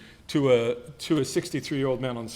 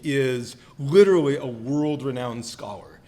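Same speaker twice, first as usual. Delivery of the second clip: usual speaking voice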